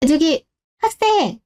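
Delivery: slow, high attention-getting call. Acted. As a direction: hail a student politely, stretched out. Clerk calls out to passing student.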